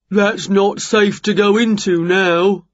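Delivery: slow builder character voice; builder Jerry, cautioning. A narrator's reading. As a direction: put on a slow-talking workman.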